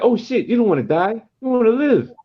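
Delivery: be blown away by the verse; impressed exclaim. Retold or imitated.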